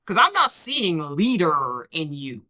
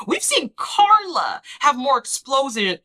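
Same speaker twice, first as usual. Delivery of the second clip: ordinary talk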